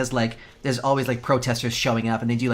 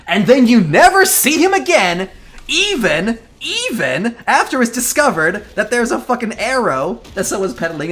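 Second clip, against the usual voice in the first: exasperated rant; 9 semitones higher, 8 dB louder than usual. Real, not acted. Exasperated rant about a plot hole.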